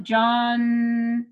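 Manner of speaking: ordinary talk, nothing marked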